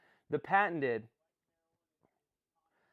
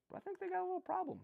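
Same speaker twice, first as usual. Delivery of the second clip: much higher, quick, sly; teasing aside; 5 dB quieter, much faster than usual. Acted. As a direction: let the dig slip out slyly. Confiding a slightly mean opinion.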